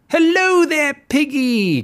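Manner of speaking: high, loud sing-song wolf greeting; wolf hailing a pig, high and loud; wolf crying out. Acted. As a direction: call out sweetly like a sly wolf. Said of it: "cried the wolf".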